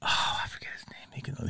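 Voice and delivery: breathy whisper while trying to remember. Real, not acted. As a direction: whisper it, searching for the name. Trying to recall name.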